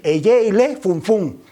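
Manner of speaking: "Eye ile fun fun" slowly, each word distinct; correct phrase. Shown as a demonstration. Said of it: "es correcto decir".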